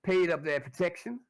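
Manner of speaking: high, loud menacing protection-racket voice; high sneering extortioner; Derek Lazenby. Acted. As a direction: lean on them with smug menace. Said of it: "Derek says".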